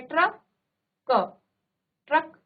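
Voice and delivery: ट्रक stretched slowly, half ट then full र; slow classroom model of ट्रक; drawn-out demo. Shown as a demonstration. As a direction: repeat ट्रक slowly for students. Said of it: "ये ट आधा है".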